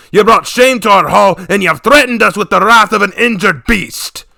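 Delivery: building to a loud, high shout; furious tirade. Acted. Father berates his daughter, building to a shout. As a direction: rage at the daughter, climbing to a roar.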